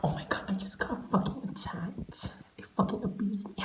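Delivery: hushed whisper; breathless whispered gossip. Real, not acted. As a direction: whisper it like a secret. Whispered aside after being attacked.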